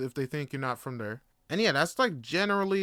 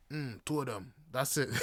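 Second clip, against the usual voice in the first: muttered order; 3 semitones lower, 8 dB quieter than usual. Retold or imitated. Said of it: "That's how I talk".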